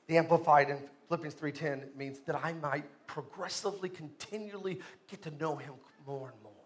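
soft, low, unhurried preaching; quiet closing sermon. Acted. Pastor calming the church at the close, softly. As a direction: bring it down to a hush.

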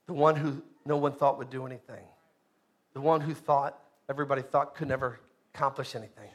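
hushed pastoral aside; quiet, intimate preaching. Real, not acted. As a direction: drop way down, soft and low. Quiet reflective stretch of preaching.

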